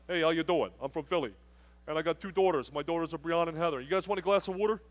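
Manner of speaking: hometown South Jersey accent, played up; Philly accent. Shown as a demonstration. Camp preacher showing teens how he grew up talking, Philly accent. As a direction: talk like a Philly local. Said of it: "I can't talk like that".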